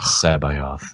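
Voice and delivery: "Sabaoth" as SAB-eye-oth; audiobook pronunciation. Shown as a demonstration. Host repeating the audiobook's version, SAB-eye-oth pronunciation. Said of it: "Is that how they say it?".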